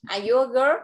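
put-on "girl" rises clearly at the end; rising question tune. Shown as a demonstration. Teacher modelling the question for the child. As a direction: ask it, pitch climbing on "girl". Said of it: "con sẽ lên giọng như vậy ha".

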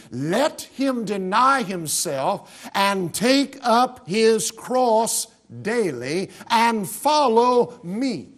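slow, solemn reading of Jesus' words; solemn pulpit quotation; scripture reading. Acted. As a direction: draw each word out like a pronouncement. Preacher reads Jesus' words.

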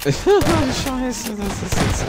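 sudden loud squeal of frustration; high frustrated yelp. Real, not acted. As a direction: yelp it, fed up with the lag. Frustrated outburst at lag.

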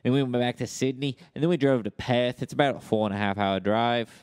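put-on improvised Aussie storyteller accent; Australian accent. Australian recounting an outback road trip, Australian accent. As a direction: ramble on as an Australian mate. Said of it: "Australian accent".

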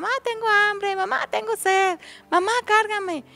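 put-on high-pitched whiny little kids calling out; clamoring children. Chickpea children pester their new mother, crying out in high voices. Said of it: "a llorar y le gritaban".

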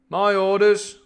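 high, loud captain's declaration; captain's command. Acted. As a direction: call it out as the ship's captain. Voices captain cutting in curtly.

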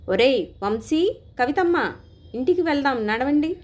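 put-on father calling his children home from a distance; calling out loudly. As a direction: shout across the park to the children. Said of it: "గట్టిగా అరిచాను".

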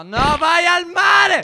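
slow, high, loud yell; drawn-out shout. Real, not acted. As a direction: stretch it out and shout. Shouted at teammate in game. (urlo lungo e acuto)

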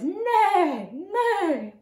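high horse-like neigh; zebra bray. Shown as a demonstration. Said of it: "kind of like a horse noise".

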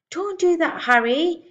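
loud, high protest; pitched well up, urgent complaint; crying out. A narrator's reading. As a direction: cry out at your husband in annoyance. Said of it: "Missus Wormwood cried out".

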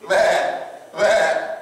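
drawn, dull 'meh' like a dumb sheep; sheep bleating. Retold or imitated. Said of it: "the sheep's gonna go".

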